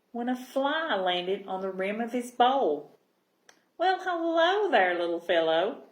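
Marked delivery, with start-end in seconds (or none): 3.8-5.8 cheerful call. A narrator's reading. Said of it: "Gilbert called out cheerfully".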